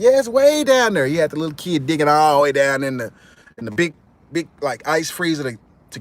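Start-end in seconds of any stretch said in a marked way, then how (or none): 0.0-1.1 much higher, louder coaxing; sly thug. Retold or imitated. A thug tricking the cousin, high loud coaxing. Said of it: "He said".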